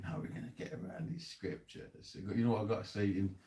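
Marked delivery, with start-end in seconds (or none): 0.0-2.1 breathy hushed scheming line; hushed mock elite line before trying the accent; hushed mock quote. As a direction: murmur it like a plotter in secret. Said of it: "they're saying".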